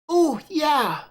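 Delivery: put-on high squeal of a kid promised cake; childish glee; delighted little girl. Clara hears there's cake, high childish glee.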